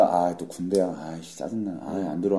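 low, quiet grumbling to oneself; annoyed grumble. Acted. Female listeners reacting to an army special, muted annoyed grumble. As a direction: grumble it under the voice, lightly irritated.

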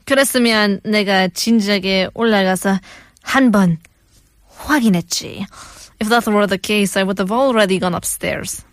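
put-on deliberate, phrase-by-phrase Russian accent; mock Russian accent, evenly measured. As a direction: read it as a put-on Russian movie heavy. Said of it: "I'll do the Russian accent".

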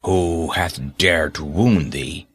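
low, slow angry question rising at the end; a giant furious at whoever hurt the child. Acted. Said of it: "his face grew red with anger".

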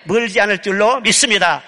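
normal talking voice

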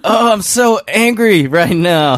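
mock angry.

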